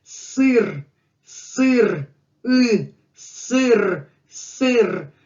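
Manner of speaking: "сыр" with a stretched, falling ы and rolled r; Russian ы demonstration. Shown as a demonstration. Teacher models a sound English lacks, stressing ы in "сыр". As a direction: say "сыр" slowly apart, leaning on the ы. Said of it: "English language doesn't have anything like that".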